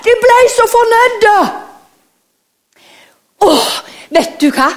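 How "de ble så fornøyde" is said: excited. Acted. Joyful punchline.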